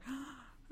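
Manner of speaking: short mock gasp of amazement; sharp audible intake, put on; imitated gasp. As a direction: draw in a sharp little breath, imitating onlookers. Imitates others' delighted gasp at her.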